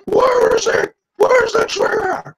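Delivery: high loud strained yell; Batman yell impression. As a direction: imitate Bale screaming the question. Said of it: "when he was yelling".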